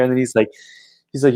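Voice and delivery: ordinary delivery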